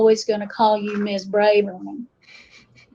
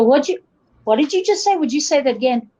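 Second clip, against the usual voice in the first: puzzled outsider; 3 semitones higher, faster than usual. Retold or imitated.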